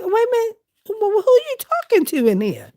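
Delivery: high, startled questions aimed at the cat; pet talk. Retold or imitated. Quizzing the hissing cat, in high startled pet talk.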